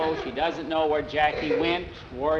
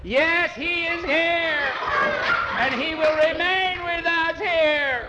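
very high, slow proclamation; high, drawn-out pulpit cry; fervent preacher; 13 semitones higher, slower than usual. A narrator's reading. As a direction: wail it out slowly like a disturbed preacher. Reverend insisting over the cries.

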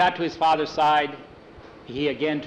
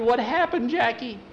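put-on little girl; 9 semitones higher than usual. A narrator's reading.

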